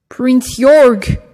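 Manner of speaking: high, loud shout; shout over the rain. Acted. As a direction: yell to be heard over a downpour. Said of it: "Father Gumps had to shout over the rain".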